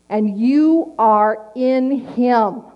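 ordinary talk

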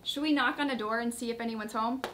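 normal talking voice

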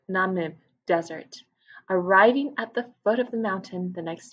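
put-on lively read-aloud for children; story narration. A narrator's reading. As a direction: tell it to a class of young kids. Reads story aloud to class.

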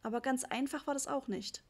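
ordinary delivery, nothing marked

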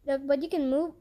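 ordinary delivery

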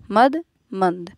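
मद then मंद very slowly, the second with a nasal n; slow contrast drill. Shown as a demonstration. Teacher showing students मद versus मंद, slowly. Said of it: "ये मद है और यहाँ क्या हो गया".

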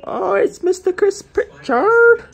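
high, slow, drawn-out grand introduction; announcing a friend. Pretend. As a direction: call his name like a ring announcer. Mock grand greeting of friend.

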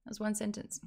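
hushed echo of the line. Shown as a demonstration. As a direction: That was one sentence dropped to a soft aside, one stressed.

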